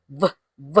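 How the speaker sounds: "v, v" as two short buzzed vuh sounds. Shown as a demonstration. Teacher showing the V sound, V sound. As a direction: sound out "v" alone, separately. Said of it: "V sound is".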